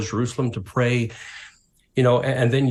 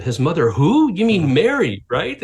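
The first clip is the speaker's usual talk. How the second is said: puzzled surprise; 6 semitones higher, 6 dB louder than usual. Retold or imitated. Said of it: "I said".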